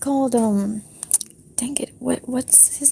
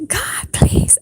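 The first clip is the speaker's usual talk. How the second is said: higher, pushed-up whispered plea; louder prayer; 12 semitones higher than usual. Shown as a demonstration. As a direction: raise the plea up high. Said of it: "Pray louder".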